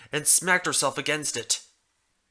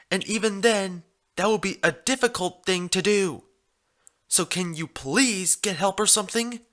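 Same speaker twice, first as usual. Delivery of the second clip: put-on pleading young mare; 6 semitones higher than usual.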